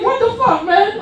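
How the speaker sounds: high-pitched mock outrage; acted protest. Comedian answering his teasing friend, high-pitched mock outrage. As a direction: protest in a squeal, half laughing. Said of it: "I'm like".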